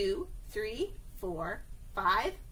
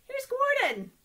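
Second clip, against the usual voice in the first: high, louder proud reveal; excited reveal; 13 semitones higher, 5 dB louder than usual. Acted. Teacher proudly showing the children her train, high and loud. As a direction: show it off like a treasure.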